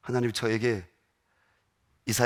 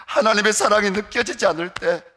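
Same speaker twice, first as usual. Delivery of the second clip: preaching climax; 9 semitones higher, 7 dB louder than usual. Acted.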